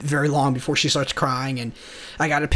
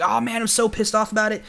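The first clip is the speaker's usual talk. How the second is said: upset rant; 7 semitones higher, faster than usual. Retold or imitated. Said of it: "getting all upset and saying".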